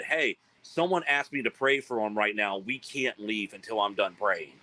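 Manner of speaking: ordinary delivery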